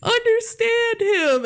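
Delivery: over-the-top earnest mentor; exaggerated mentor. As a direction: stretch it out mockingly. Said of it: "repeating the quote for laughs".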